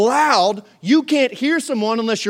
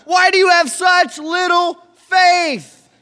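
loud, high, drawn-out rebuke; slow insistent shout; 8 semitones higher, 6 dB louder, slower than usual. Retold or imitated. Jesus repeating his question to the disciples, slow shout. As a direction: press the question over the wind. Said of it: "And he's like".

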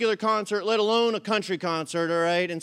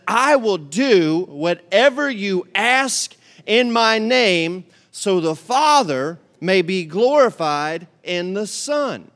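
scripture reading; 6 dB louder, much slower than usual. Acted. Congregation reads scripture aloud.

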